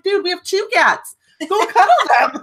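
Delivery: high, incredulous mock retort; exasperated mom. Mom answering her crying son, incredulous and high. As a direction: toss it back, half laughing. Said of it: "I'm like".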